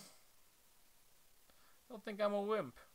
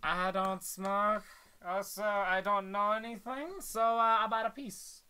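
mock nervous; 4 semitones higher, 5 dB louder than usual. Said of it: "I'm like".

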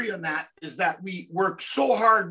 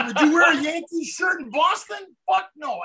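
higher-pitched, amused mock indignation; retold indignant comeback; 7 semitones higher, 5 dB louder than usual. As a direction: throw the question back, playfully outraged. Retold heated comeback, laughing along.

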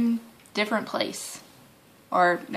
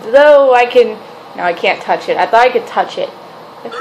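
ordinary talk

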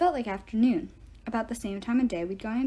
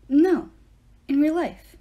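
louder, pressing; insist, raised voice; insistent; 6 semitones higher, 10 dB louder, slower than usual. A narrator's reading. Percy insisting.

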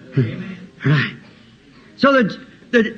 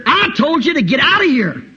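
high, insistent outburst; acted furious yell; angry order; 6 semitones higher than usual. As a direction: lose your patience and throw them out. Boy orders governor out.

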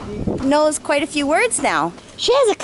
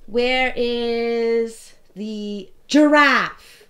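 put-on reading to a toddler; 6 semitones higher, 3 dB quieter, much slower than usual. Mother naming pictures with toddler.

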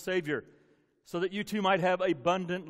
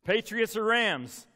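teasing loud question; 5 semitones higher, 8 dB louder, slower than usual. Acted. Teasing Kelly.

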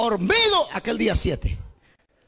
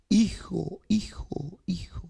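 fading whisper, each softer; whispered echo; 5 dB quieter, slower than usual. Retold or imitated. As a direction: let the echo die away in a whisper. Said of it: "salió un eco".

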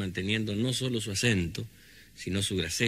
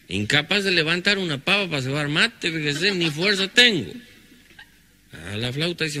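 higher rustic dialogue; country folk voices; 7 semitones higher than usual. Acted. Healer asking the patient with kidney pain, pitched up. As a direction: play the old healer and the sore patient.

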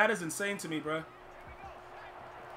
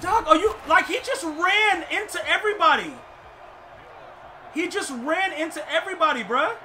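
higher, louder exasperated yell; outraged yelling; 8 semitones higher, 6 dB louder than usual. Real, not acted. Player ranting at the game's glitchy tackle, loud indignant yell. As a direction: shout your disbelief at the screen. Gamer outraged at bad play.

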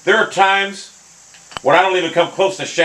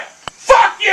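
high, explosive angry yell; angry shout; 4 dB louder than usual. Real, not acted. Gamer cursing the game after a bad putt, angry shout.

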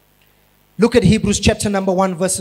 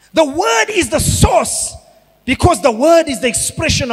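preacher hammering the point; emphatic preaching; 5 semitones higher than usual. Acted.

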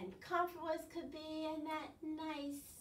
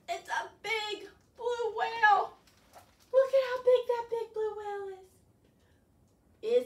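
excited awe; 8 semitones higher, 10 dB louder, slower than usual. Real, not acted. Teacher marvels at whale.

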